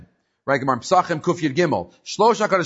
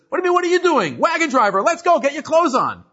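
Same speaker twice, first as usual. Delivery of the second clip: much higher, louder mock outrage; brazen indignation; 12 semitones higher, 4 dB louder than usual. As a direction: bluff it out, ordering the boss around. Acted quarrel, wagon driver.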